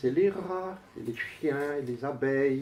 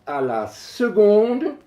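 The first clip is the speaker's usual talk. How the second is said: high-pitched, emphatic recitation; poem declamation; 11 semitones higher, 8 dB louder, slower than usual. Acted. Poet proclaims staggering computer figures to the audience, declaimed. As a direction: proclaim each figure with suspenseful pauses.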